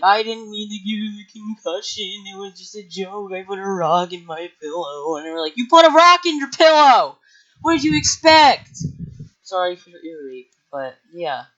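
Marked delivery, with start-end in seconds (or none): 0.0-5.2 mock crying. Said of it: "Manny's crying because he's like". 5.6-8.8 loud, high-pitched yell; retold outraged shout. Retold angry comeback to Manny.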